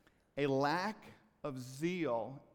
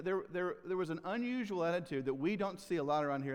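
ordinary delivery

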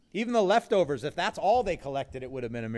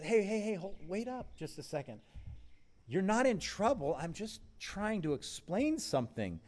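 higher, calming hold-on; reassuring Jesus; 6 semitones higher than usual. Acted. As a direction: settle a frightened friend gently. Jesus reassures the guilty disciple.

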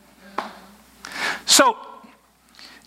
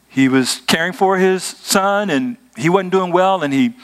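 ordinary delivery

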